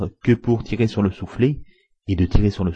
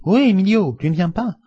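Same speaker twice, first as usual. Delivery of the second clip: high, loud hailing call; cheerful villagers calling loudly; 12 semitones higher, 5 dB louder than usual. A narrator's reading. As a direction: shout it across the street to a friend.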